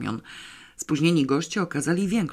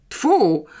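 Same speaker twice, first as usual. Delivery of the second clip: sudden loud, higher tfu of disgust; disgusted spit; 6 semitones higher, 7 dB louder than usual. A narrator's reading. Teacher at the door seeing the square, disgusted spit. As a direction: spit it out in revulsion. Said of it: "powiedział nagle ze wstrętem".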